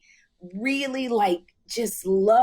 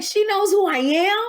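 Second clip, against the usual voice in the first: disbelief; 12 semitones higher, 6 dB louder than usual. Retold or imitated. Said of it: "I was like".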